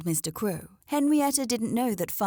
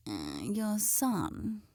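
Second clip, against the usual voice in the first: sniffing; 6 dB quieter, much slower than usual. A narrator's reading.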